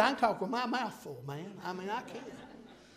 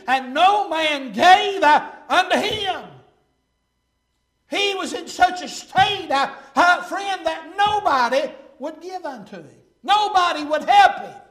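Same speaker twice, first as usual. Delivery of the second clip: loud, slow, rising preacher cry; preaching at full force; 5 semitones higher, 8 dB louder, slower than usual. Acted. Preacher crying out to his congregation, full force. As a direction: drive the verse home to the church.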